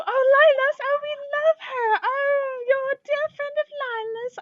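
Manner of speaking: high-pitched adoring squeal, drawn into a coo; gushing shopkeepers. Acted. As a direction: coo over a dear friend in a very high voice. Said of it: "clasp each other's hands and they go".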